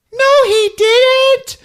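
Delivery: very high, loud squeal of denial; protesting child. Retold or imitated. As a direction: wail it slowly like a little girl. Judy defends Ralph against David.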